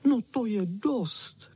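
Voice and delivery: high, drawn-out dog whine; whining chow chow. A narrator's reading. Chow chow as the dogs return, high whine. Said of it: "kničel".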